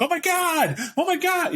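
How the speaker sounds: very high, panicked cry; panicked Xavier. Pretend. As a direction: squeal in mock terror. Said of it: "play him going".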